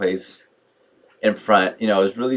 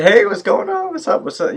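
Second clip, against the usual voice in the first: high-pitched, friendly hailing; effusive greeting; 13 semitones higher, 4 dB louder than usual. Retold or imitated. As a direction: greet a club acquaintance with a lifted voice. Re-enacts greeting the club acquaintance.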